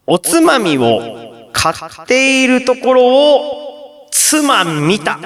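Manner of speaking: slow, high drawn-out setup, dropping for the punchline; theatrical pun delivery. Shown as a demonstration. Host presents a listener's snack pun, slow theatrical delivery. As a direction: draw every phrase out grandly.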